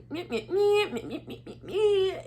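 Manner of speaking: high-pitched sassy nonsense syllables; Tiana with sassy attitude. Retold or imitated. Auditioner acts as Tiana for the judges, exaggerated sass. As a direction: mimic a line reading in sassy little mehs. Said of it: "gave her all the attitude and all the sass".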